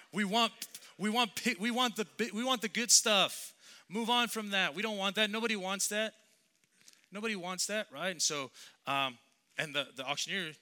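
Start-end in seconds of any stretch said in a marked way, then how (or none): 0.1-7.9 high, loud mock crowd complaint; impatient crowd. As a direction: play the whining crowd demanding the good stuff. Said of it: "the people in the crowd were just like".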